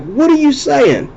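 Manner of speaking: high-pitched incredulous question; retold exasperated bafflement. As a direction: act out failing to understand someone. Acts out baffled reaction to accent.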